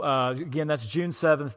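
usual speaking voice

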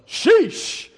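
high, loud drawn-out sheesh; mock cashier sheesh. Cashier reacts to the preacher's gray hair, with a high loud sheesh. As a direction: squeal out sheesh like an amused cashier. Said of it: "just like that".

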